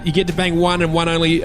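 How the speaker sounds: ordinary talk, nothing marked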